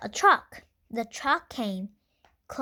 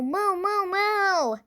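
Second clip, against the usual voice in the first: high, put-on cow mooing; 9 semitones higher, 3 dB louder, slower than usual. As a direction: moo like an excited storybook cow. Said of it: "cried Cow".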